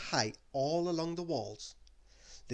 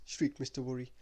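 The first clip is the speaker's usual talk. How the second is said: plain reading voice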